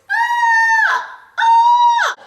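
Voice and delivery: loud, high repeated screams. Retold or imitated. The crushed car's passengers scream loud and high. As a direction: scream twice as the car is crushed. Car crumples.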